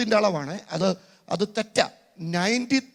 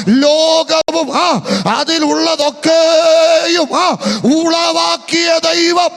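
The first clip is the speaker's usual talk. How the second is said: louder, higher slow shout; even louder; 7 semitones higher, 9 dB louder, much slower than usual. Shown as a demonstration. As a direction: lift the voice once more and shout. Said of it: "ഇച്ചിരി കൂടെ ശബ്ദം ഉയർത്തി". (കുറച്ചുകൂടി ശബ്ദം ഉയർത്തി വിളിച്ചുപറയുന്നു)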